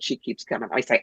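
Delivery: ordinary delivery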